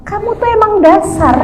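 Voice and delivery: high-pitched mock retort; scolding wife. Wife's acted retort to husband. (istri mengomel dengan nada tinggi)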